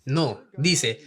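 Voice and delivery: normal talking voice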